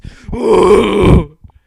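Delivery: loud, long strangled gasp; dying moose gasp. Acted. Woman in bed making a dying moose noise, loud gasp. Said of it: "It sounded something like this".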